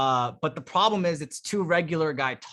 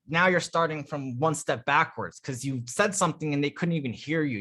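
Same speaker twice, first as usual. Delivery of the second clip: ordinary delivery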